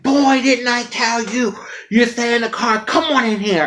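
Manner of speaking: hammed-up fatherly telling off; father scolding his son at the door.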